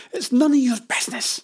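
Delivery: loud, high indignant retort; annoyed. Acted. Jenny asked about the family's debt, loudly annoyed. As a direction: shut the question down sharply. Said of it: "Jenny was annoyed at the question".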